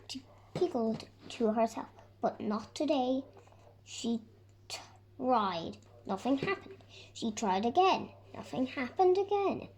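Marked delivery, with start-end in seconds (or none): none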